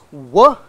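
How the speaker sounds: "w" said alone as a glide; isolated sound. Shown as a demonstration. Lecturer breaking "we" into its sounds, isolated sound. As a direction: sound out "w" on its own. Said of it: "At the level of sounds".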